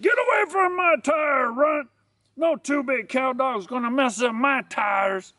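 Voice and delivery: high, strained snarl of a territorial dog; threatening boxer dog. Acted. Boxer warns a runt away from his tires, threatening. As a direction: warn them off in a high, put-on dog voice. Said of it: "didn't like that tone of his voice".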